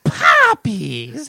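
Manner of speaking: high-pitched witchy cry; Wicked Witch impression. Imitates Wicked Witch of the West.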